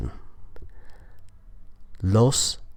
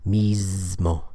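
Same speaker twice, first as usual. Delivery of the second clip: "Mismo" stretched out, with a long buzzed Z; slower demonstration. Shown as a demonstration. Teacher saying it slower for learners, "Mismo" drawn out with voiced Z. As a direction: draw out the Z in "Mismo" slowly. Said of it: "I'll say it a little bit sl-, slower".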